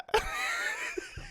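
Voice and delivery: high, soft wheezing laughter; helpless laugh. Real, not acted. Cracking up at a church meme, wheezy laughter. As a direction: dissolve into high breathy giggles.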